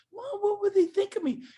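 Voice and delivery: high, whiny worried voice; mock the timid ego; mocking insecure voice. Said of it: "Your ego is keeping you so broke".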